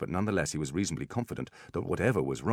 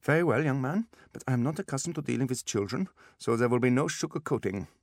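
put-on loud, higher German-accented warning; brusque German accent, out of patience; irritated German doctor; 8 semitones higher, 4 dB louder than usual. A narrator's reading.

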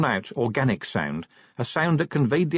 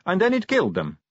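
Sally's voice; 9 semitones higher than usual. Acted. Vampire Sally speaks from behind.

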